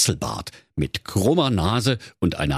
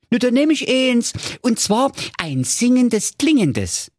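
delighted. Acted. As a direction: gush with delight. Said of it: "sagte er entzückt".

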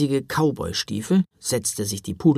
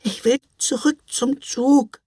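put-on high, small child's plea; a little girl begging to turn back; little sister pleads with her brother to go back; plaintive little girl; 12 semitones higher, 3 dB louder than usual. A narrator's reading. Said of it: "bibberte sie kläglich".

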